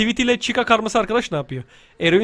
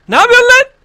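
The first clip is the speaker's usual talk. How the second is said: very high, loud outburst; startled yell; 14 semitones higher, 8 dB louder than usual. Real, not acted. Player challenges a game enemy, startled high yell. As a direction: yell it in high-pitched protest.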